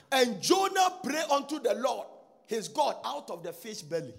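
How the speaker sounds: slow, ringing opening that settles as it goes; preached scripture reading. Acted. Preacher reading Jonah's verse to the church, declaimed. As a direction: declaim it high and loud, easing down at the end.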